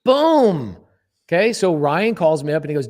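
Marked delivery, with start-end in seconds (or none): 0.0-0.8 loud, high, punchy boom; a big mic-drop boom; triumphant boom. Real, not acted. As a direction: slam the punchline home.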